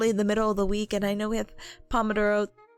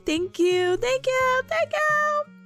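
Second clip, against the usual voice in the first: rising higher with each thank you; excited thanks; 12 semitones higher, much slower than usual. Real, not acted. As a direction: build up squeakier each time.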